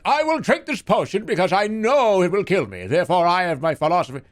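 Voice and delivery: high, loud, grand philosopher voice; hammed-up noble speech; mock Socrates. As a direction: declaim like a pompous ancient sage.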